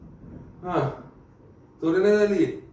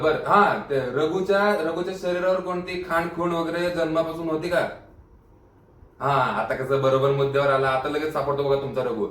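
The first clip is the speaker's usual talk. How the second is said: ordinary delivery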